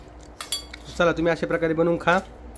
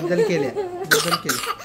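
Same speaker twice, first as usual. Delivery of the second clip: put-on much higher, playful copy of the child's jumbled बद्दल केल्या; mimicking toddler; 13 semitones higher than usual. Father teasing daughter's jumbled word order, high childlike mimic. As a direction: echo the little girl's mix-up in a high cute voice.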